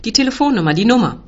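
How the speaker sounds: high, loud, urgent call; shouted demand. A narrator's reading. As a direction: shout for it insistently. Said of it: "ruft er".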